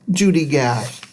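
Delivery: usual speaking voice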